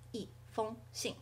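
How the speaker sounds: 一封信 word by word, falling, level, falling; fourth, first, fourth tone. Shown as a demonstration. Teacher repeating the tone pattern for learners, 一封信 in fourth-first-fourth. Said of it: "and then fourth tone again".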